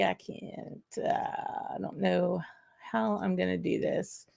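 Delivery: muttered aside. Real, not acted. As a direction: mumble under your breath while fiddling. Muttering to himself over tech.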